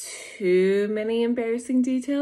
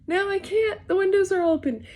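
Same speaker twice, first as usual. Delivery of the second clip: high, louder protest; retold panicked protest; 11 semitones higher, 4 dB louder than usual. Naked wife refusing because windows are open, high mock-panicked protest. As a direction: protest high and loud, acting it out.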